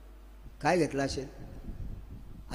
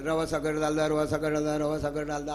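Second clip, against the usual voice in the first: low, monotone rote chanting; child memorizing by repetition; 5 semitones lower than usual. Acted. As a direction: repeat it over and over like a memorized list. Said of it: "असं घोकत घोकत गेलं". (एका सुरात घोकंपट्टी)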